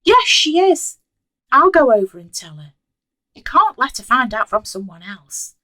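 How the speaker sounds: storming. A narrator's reading. Mother storming out on her daughter, angry. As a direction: snap back and storm off. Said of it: "storming out of Shelley's house".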